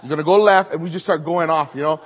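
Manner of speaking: ordinary talk, nothing marked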